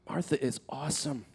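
usual speaking voice

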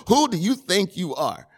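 head-shaking, full of attitude. Shown as a demonstration. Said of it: "You can kinda hear the attitude in my voice".

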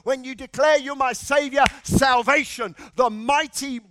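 loud, rising sermon drive; preaching at full force. Acted. Preacher declaring abundant life over his listeners. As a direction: press the promise home.

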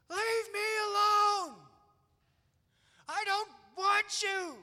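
put-on loud, high, drawn-out child's scream; imitated tantrum screaming. Dad acting out his daughter in the prayer room, screaming. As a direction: shriek slowly like a child mid-tantrum. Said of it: "She's screaming".

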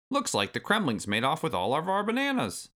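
high-pitched crabby granddad; cantankerous old ape, astonished; Cranky Kong. A narrator's reading. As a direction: exclaim like an indignant old-timer.

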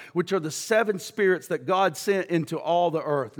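usual speaking voice

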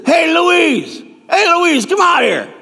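loud, high call toward the back room. Acted. Diner owner yelling for his wife to come out, loud and high. Husband calls wife from kitchen.

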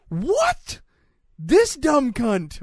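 normal talking voice

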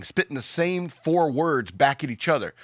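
usual speaking voice

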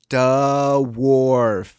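put-on child's duh wharf. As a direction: imitate a five-year-old splitting "Duh, wharf.". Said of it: "he'd be like".

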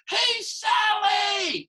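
high, loud, drawn-out yell; calling out. Retold or imitated. A man in the audience calling for his wife, loud yell. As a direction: holler across a crowd to find someone.

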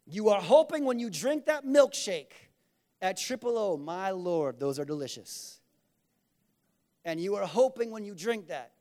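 normal talking voice

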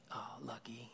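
breathy whisper, a little envious; whispered envy. Retold or imitated. Dad retelling his son's hushed envious reply. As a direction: whisper it like a disappointed boy.

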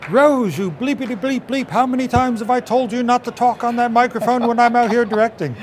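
high, loud furious yell at the crew; screaming director. Retold or imitated. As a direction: scream it like a director losing his temper. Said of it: "Willard screams out".

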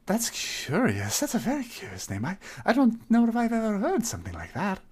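high, stammering nervous greeting; timid satyr voice. Acted. To the tavern crowd, a high, stammering shy hello. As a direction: squeak out a shy hello at the bar.

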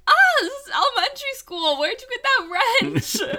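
high, loud indignant squeal. Retold or imitated. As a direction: squeal it out, outraged. Retold own indignant reaction.

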